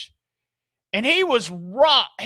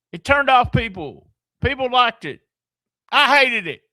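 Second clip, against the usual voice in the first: louder, mocking voice of the reviewer; 3 semitones higher, 5 dB louder than usual. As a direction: mimic the confused fan, a bit louder. Voicing contradictory reviewer.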